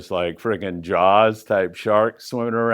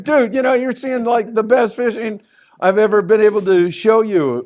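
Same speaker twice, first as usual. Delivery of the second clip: exasperated dad; 10 semitones higher, 5 dB louder than usual. Retold or imitated. Exasperated dad to bored kids.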